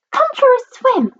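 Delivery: very high, squeaky little cry; Mister Small. A narrator's reading. The small one inviting his friend to swim, high piping call. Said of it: "cried Mister Small".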